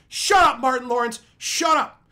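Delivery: loud, high exasperated shout; yelling. Retold or imitated. Viewer telling Martin Lawrence to shut up, reenacted yell. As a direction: yell it at the actor on screen. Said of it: "I literally yelled at the T V".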